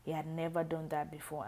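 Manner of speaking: plain reading voice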